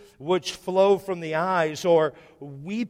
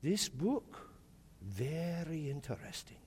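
imitating old man; 12 dB quieter than usual. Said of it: "and he goes".

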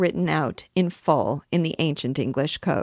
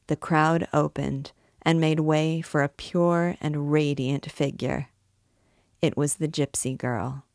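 plain reading voice

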